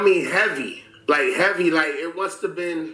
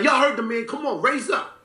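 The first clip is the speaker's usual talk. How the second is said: hyped eviction; 9 semitones higher than usual. Retold or imitated. Kicking girls out, hyped.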